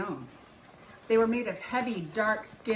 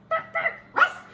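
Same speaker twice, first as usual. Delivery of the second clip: high yappy dog barks; barking dog; 6 dB louder than usual. Acted. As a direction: bark like a husky. Lead dog barking at Jack.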